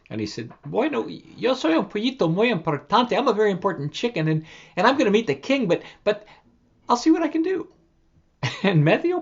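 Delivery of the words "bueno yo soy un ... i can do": high boastful chick; brag, then give in; proud little chick. Acted. Chick answers the wind.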